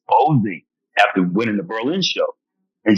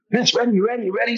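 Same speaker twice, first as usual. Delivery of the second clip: high urgent call to go on stage; 12 semitones higher, faster than usual. Retold or imitated. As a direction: hurry him out, pitched up. Calling him to the stage.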